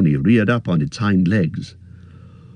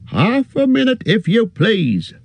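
high call tossed back over the shoulder; shouted over shoulder; 13 semitones higher than usual. A narrator's reading. Busy innkeeper calls back to Frodo, high and hurried. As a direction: innkeeper calling out mid-bustle, much higher. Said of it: "shouted the man over his shoulder".

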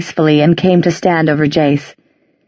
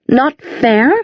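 mocking echo; 6 semitones higher than usual. A narrator's reading. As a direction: repeat it back, pitched up in scorn. Inquisitor mocks Isabel's words.

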